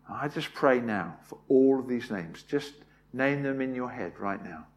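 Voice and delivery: soft, hushed, reverent prayer. Real, not acted. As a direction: pray it quietly and sincerely. Leads a closing prayer.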